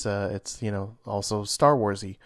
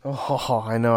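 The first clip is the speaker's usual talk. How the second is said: normal talking voice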